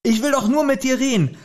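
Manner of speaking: high, urgent shout of stop; read-out shout. Acted. Reading the book aloud, Justus shouts at Ellen to stop. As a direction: cry out high to make him stop. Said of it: "schrie er".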